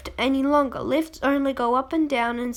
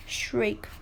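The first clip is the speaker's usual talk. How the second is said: plain reading voice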